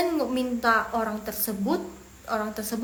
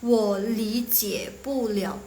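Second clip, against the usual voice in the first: drawn-out, syllable-by-syllable Mandarin; slow model reading; much slower than usual. Shown as a demonstration. Teacher introduces phrase number two, slow model reading. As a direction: sound out each word slowly. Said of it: "Next yang nomor dua".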